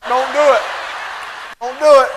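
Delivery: hammed-up warning shout; urgent mock warning. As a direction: call down the aisle to stop them. Calling down aisle to stop mom.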